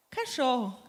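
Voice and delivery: ordinary delivery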